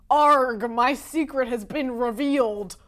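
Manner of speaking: loud mock villain cry; hammed-up villain. Author pretending his secret plot is exposed, hammy villain. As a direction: ham up a foiled villain.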